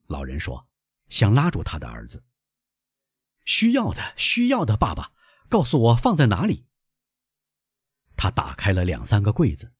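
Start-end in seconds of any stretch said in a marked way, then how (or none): 3.5-6.7 put-on high, pressing young voice; insistent young son. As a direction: insist urgently to your father, pitched up. Son insists on finding wine.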